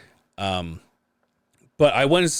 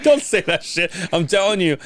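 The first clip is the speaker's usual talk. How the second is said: higher, louder telling-off, half joking; mock scolding; 10 semitones higher, 3 dB louder than usual. Visitor scolds friend in hospital, mock telling-off. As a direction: scold him like a buddy, higher.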